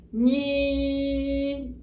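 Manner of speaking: ÑI held long on one even note; stretched, level ÑI; sustained letter sound. Shown as a demonstration. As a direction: sound out ÑI as a steady hum. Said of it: "¿Cómo suena?".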